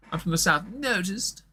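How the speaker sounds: high-pitched posh comic delivery; hammed-up posh southerner; Michael McIntyre. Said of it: "pulls a really posh face".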